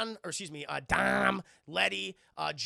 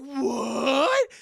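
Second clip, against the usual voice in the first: higher, much louder shocked cry; shocked what; 7 semitones higher, 13 dB louder than usual. Retold or imitated. Mia hearing Brian is a cop, loud shocked cry. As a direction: gasp it out in disbelief.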